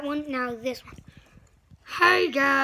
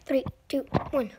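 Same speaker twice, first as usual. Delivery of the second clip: ordinary talk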